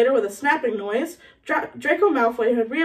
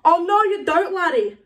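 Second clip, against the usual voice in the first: high, loud cry to stop someone; roared reprimand; 6 semitones higher, 4 dB louder than usual. Acted. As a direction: stop him in his tracks, loud and high. Moody roars at Malfoy.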